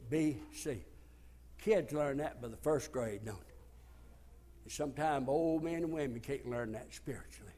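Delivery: low, soft, gentle invitation; soft altar call. Real, not acted. Preacher inviting sinners forward, low and soft. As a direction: draw them in quietly. Quiet invitation over music.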